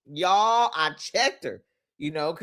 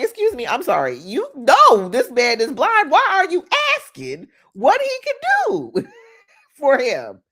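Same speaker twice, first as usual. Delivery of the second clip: high, disbelieving protest with a laugh; incredulous crowd; 7 semitones higher, 4 dB louder than usual. Retold or imitated. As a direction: scoff at the question as absurd. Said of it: "everybody around Jesus was like".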